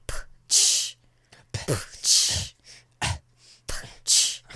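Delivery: wet popped puff into a hiss; mouth-made gross squelching noise, repeated; imitated squelch. Imitating a character digging in his eye, gross squelch. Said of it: "making these gross squelching".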